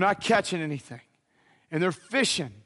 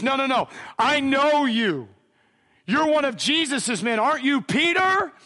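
raised, high-pitched insistence; insistent accusation; 7 semitones higher, 4 dB louder than usual. Retold or imitated. Somebody in the crowd confronts Peter, loudly insistent. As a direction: call him out, climbing higher on the name.

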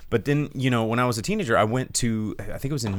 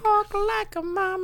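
high, put-on mummy voice; dusty mummy rasp. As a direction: chant it like a movie mummy. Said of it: "and he's like".

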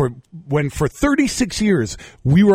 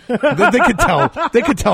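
laughing, higher and louder; 12 semitones higher, 5 dB louder than usual. Real, not acted. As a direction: laugh through the words. Laughing while talking.

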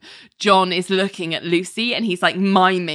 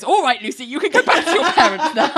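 laughing; 8 semitones higher, faster than usual. Retold or imitated. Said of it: "And the judge goes".

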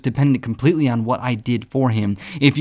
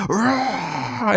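roar; 6 semitones higher than usual. Retold or imitated. King Kong coming.

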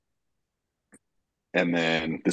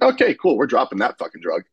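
sudden resolve; 6 semitones higher, 4 dB louder than usual. Retold or imitated. Quoting own snap decision.

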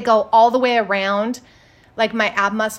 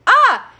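sharp high yelp of pain, loud; 13 semitones higher, 9 dB louder than usual. Retold or imitated. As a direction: yelp as if pressed right on the sore spot.

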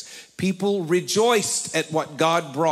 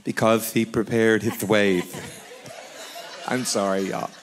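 laughing. Real, not acted. Laughing through the reading.

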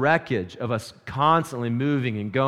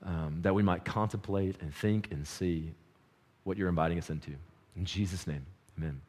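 quiet prayer; 6 semitones lower, 8 dB quieter than usual. Acted. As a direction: lead the church in gentle prayer. Pastor prays before sermon.

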